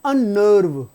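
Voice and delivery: अननर्व said with a clear double n, an-nerv; teacher says अननर्व slowly with the n doubled; spelling-out pronunciation. Shown as a demonstration. Teacher showing the class how to say अननर्व, with a doubled n. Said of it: "नर्व नहीं है".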